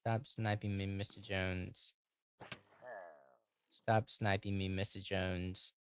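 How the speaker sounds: lightly played polite protest, dying away at the ends; put-on voice. Telling Mister Jones to leave, put-on voice. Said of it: "stop doing that voice".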